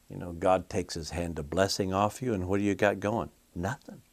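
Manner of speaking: normal talking voice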